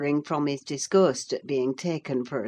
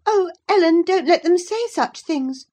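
put-on high, loud girlish plea; greatly troubled; 14 semitones higher, 9 dB louder than usual. Girl appeals to her housekeeper, greatly troubled. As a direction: plead in high-voiced distress. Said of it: "she pursued in great trouble".

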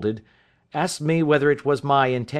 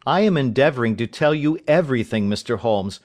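ordinary delivery

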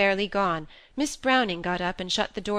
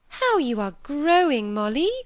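anxious to please; 8 semitones higher, 6 dB louder than usual. A narrator's reading. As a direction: cover the awkwardness with admiration. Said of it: "anxious to cover over her sister's displeasure".

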